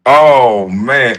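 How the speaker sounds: ordinary talk, nothing marked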